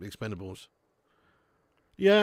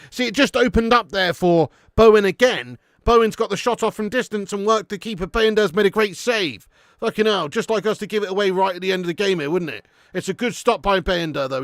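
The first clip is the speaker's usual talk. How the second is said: excited commentary; 6 semitones higher, 9 dB louder than usual. Real, not acted. Live match commentary.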